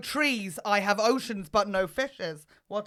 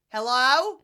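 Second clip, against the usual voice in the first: ordinary talk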